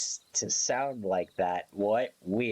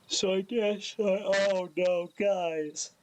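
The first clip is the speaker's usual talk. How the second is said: yawning; 9 semitones higher than usual. Real, not acted. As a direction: voice pulled up by a yawn. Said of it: "Guys, I'm yawning".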